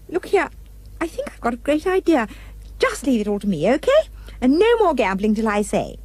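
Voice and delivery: lightly voiced bossy young girl. A narrator's reading. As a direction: take charge brightly.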